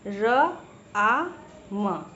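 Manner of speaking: "र, आ, म" as three separate sounds with pauses; broken into sounds. Shown as a demonstration. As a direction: sound out "र, आ, म" slowly, one at a time. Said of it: "अगर हम राम को तोड़ते हैं".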